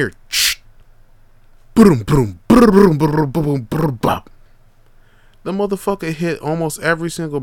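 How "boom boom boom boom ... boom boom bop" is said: loud mock thuds; crash sounds. Telling how Khalil fell, loud imitated crashes. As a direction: bang out each branch hit. Imitating fall through branches.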